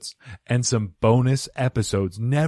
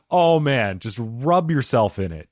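ordinary talk

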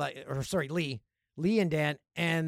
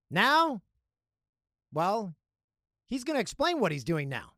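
ordinary delivery

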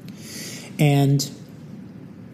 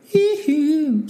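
high-pitched pop-star "hee-hoo"; mock falsetto squeal. Imitates a squeal after moonwalking.

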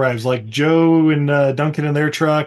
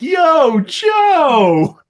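high, drawn-out holler; long gleeful mock call; happy mock yell; 12 semitones higher, 4 dB louder than usual. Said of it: "does he yell out".

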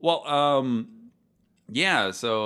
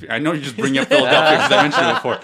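laughing; 2 semitones higher, 12 dB louder, faster than usual. Real, not acted. Teasing a friend about Philadelphia.

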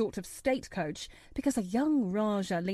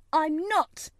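high, loud, angry denial; furious cry; 7 semitones higher, 5 dB louder than usual. A narrator's reading. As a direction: throw the denial out angrily. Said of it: "he cried up furiously".

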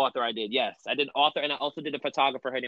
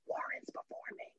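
whispered exclusive; secretive whisper; 18 dB quieter than usual. Pretend. Guest leaks an exclusive to the host, secretive whisper. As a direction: leak it in a hushed, voiceless whisper.

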